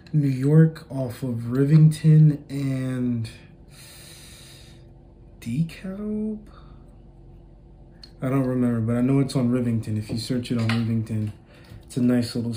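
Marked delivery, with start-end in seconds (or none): 5.4-6.4 DeKalb drawn out on a high questioning rise; high, tentative "DeKalb?"; unsure question. Real, not acted. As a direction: guess at DeKalb, pitched up as a question. Trying to recall a street name.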